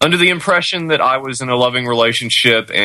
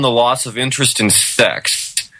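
usual speaking voice

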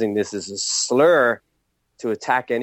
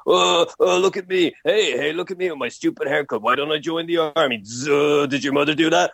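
mocking friend; 5 semitones higher than usual. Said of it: "every single slag that that person could say".

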